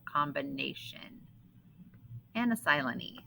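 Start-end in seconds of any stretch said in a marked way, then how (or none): none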